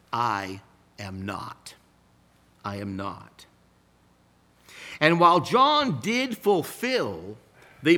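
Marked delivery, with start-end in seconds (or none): 0.1-3.4 low, soft and slow, a quiet firm denial; John's denial. Retold or imitated. John answering the priests, quiet and low. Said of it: "John says".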